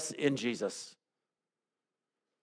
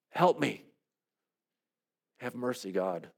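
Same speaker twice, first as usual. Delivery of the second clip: hushed plea; 4 semitones lower, 8 dB quieter than usual. Acted. Desperate plea in prayer.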